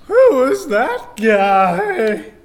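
put-on excited teen. Teen hero asking about Wong, high and slow. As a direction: drag it out like a puzzled teenager.

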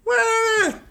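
high shocked what; mock indignant what. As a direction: squeal it like outraged teens. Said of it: "They're like".

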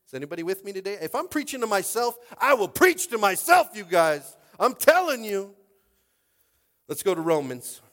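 very loud, high pulpit shout; full-force preaching. Acted. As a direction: shout to rouse the crowd.

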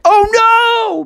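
high, drawn-out cry of dismay; alarmed cry. Acted. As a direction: wail it as the disaster unfolds. Frog sees arrow heading for friend.

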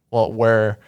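ordinary talk

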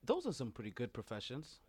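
low, quiet teacher voice; a nervous sub calling on kids; flustered substitute teacher. Acted. Teacher voice continues.

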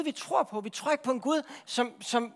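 normal talking voice

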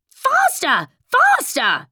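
high, falling cries to hurry; urgent cry. A narrator's reading. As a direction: urge a runner on in a high voice. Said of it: "still the Queen cried".

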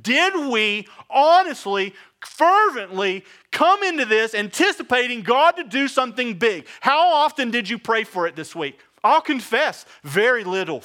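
full-force preacher's challenge; preaching. Acted. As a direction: press the congregation hard. Preacher opens with pointed questions.

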